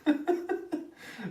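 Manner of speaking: high-pitched burst of giggles; laughing. Real, not acted. Streamer laughs at own American accent, giggling. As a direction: crack up at your own impression.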